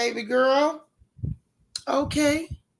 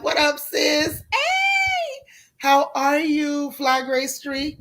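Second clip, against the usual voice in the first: excited greeting; 5 semitones higher, slower than usual. Real, not acted. Host greeting a friend joining the live chat, excited high call. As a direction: shout hello across the porch like she just walked in. Calling out to arriving viewer.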